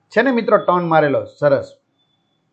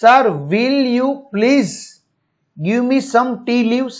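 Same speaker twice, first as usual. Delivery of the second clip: very slow, higher, each word apart; teacher reads Rupesh's line very slowly; slow polite request; 5 semitones higher, much slower than usual. Acted. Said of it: "Rupesh".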